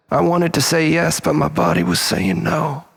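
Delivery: ordinary delivery, nothing marked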